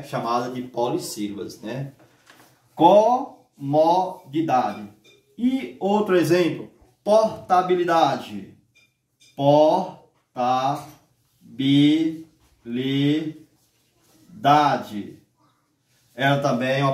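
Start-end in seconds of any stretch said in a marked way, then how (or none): 2.7-4.9 comodidade slowly split co-mo-di-da-de; syllable by syllable. Shown as a demonstration. Teacher to class, counting the syllables of comodidade, slow. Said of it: "palavra que tem cinco sílabas". 9.3-15.2 Portabilidade slowly split por-ta-bi-li-da-de; syllable by syllable. Shown as a demonstration. Teacher to class, counting the syllables of Portabilidade, very slow. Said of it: "palavra que tem seis sílabas".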